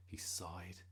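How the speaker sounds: plain reading voice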